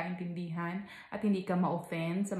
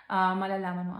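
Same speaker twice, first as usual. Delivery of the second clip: ordinary talk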